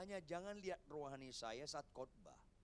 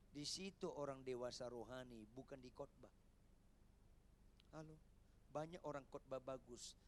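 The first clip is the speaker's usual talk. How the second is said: ordinary delivery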